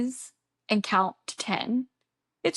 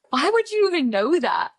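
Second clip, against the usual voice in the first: amused disbelief; 9 semitones higher, 4 dB louder than usual. Retold or imitated. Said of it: "I was like".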